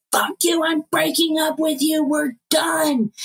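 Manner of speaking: high, heated breakup outburst; mock rage. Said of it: "he just looks so rageful".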